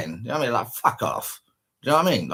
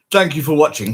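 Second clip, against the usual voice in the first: higher, louder mock-polite sign-off; sarcastic imitation of a TV sign-off; mocking the TV host; 4 semitones higher, 7 dB louder than usual. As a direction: sneer it like the smug presenter.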